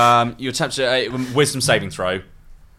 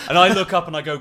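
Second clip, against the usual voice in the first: ordinary delivery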